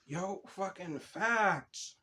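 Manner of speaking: very slow, drawn-out agreement. Real, not acted. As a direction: drag it out in full agreement.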